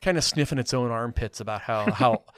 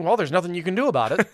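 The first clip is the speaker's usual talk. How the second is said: higher, fast shrugging tone; mock resignation; 6 semitones higher, 4 dB louder, faster than usual. Critic parodying the film's message, fast mock resignation. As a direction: brush it off quickly, mocking. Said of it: "its position ends up being".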